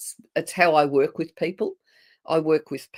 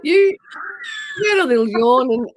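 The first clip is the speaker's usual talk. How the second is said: excited; 9 semitones higher, 6 dB louder, faster than usual. Real, not acted. Teasing about the on-air yawn.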